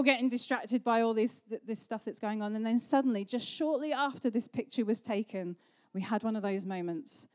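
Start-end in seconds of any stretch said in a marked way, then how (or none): none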